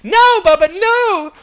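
high, loud comic scream; mock terrified scream. A narrator's reading. Band screaming at the big guy in jail, mock terror. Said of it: "we all pee ourselves and scream".

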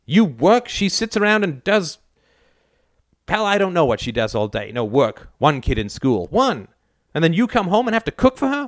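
mother ranting at her son about modern women; scornful mother. Acted. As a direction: lecture him with contempt.

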